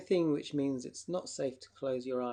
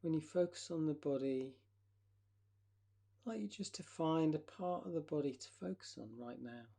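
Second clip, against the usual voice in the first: guided relaxation. Acted.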